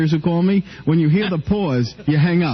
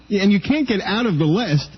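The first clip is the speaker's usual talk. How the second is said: ordinary talk, nothing marked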